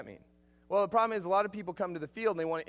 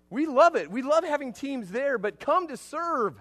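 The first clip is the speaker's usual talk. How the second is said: higher, louder, slowing on come to serve; preacher swept up in his own point; excited; 9 semitones higher, 9 dB louder, slower than usual. Real, not acted. Preacher to congregation, getting carried away with excitement. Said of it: "I can't believe I'm getting so excited".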